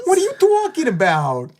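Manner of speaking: put-on high, loud New York accent. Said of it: "is my New York accent better".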